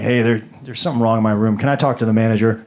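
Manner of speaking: low, fast guest complaint; deep quick customer grumble; low mock complaint. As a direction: rattle it off low like a tired guest. Guest complaining at front desk.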